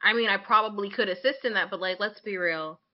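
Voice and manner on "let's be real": ordinary delivery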